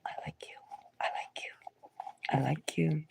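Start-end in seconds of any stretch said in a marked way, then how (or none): 0.1-1.5 breathless whispered confession; very soft whispered I like you. Shown as a demonstration. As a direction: whisper it shyly to a crush. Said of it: "give somebody".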